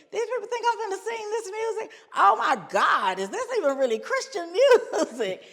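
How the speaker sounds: high, incredulous voice breaking into laughter; hammed-up amused outrage to friends; laughing disbelief. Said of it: "I just burst out laughing".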